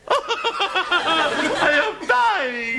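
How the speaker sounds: slow dramatic wail, laughing; melodramatic wail. Acted. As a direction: ham up dying of illness. Melodrama over thermometer.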